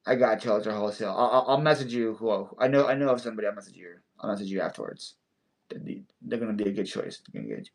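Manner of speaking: ordinary delivery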